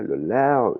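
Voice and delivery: "lao" high, then falling away; high-pitched tone. Shown as a demonstration. Teacher isolates the red lao, high tone on "lao". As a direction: lift "lao" high and stretch it. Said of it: "il est aigu".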